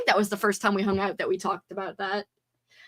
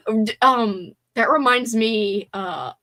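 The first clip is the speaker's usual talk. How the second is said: ordinary talk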